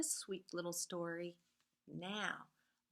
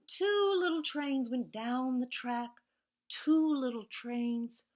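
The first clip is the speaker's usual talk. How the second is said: lively storybook reading with whispered refrains; reading to children; 4 dB louder than usual. A narrator's reading. Teacher reads Two Little Trains to the class, sing-song storytelling. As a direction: read it like a bedtime picture book, rhythmic and playful.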